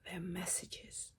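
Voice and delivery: slow, fading whisper; slow it down and let it trail away; suspense whisper. Real, not acted. Building suspense over the messages, slow fading whisper.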